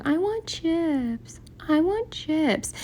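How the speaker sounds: high, soft, drawn-out whine; whining child. Retold or imitated. Little girl begging her mom for chips, whining. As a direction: whine it slowly, like a pleading little kid. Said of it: "she kept whining and going".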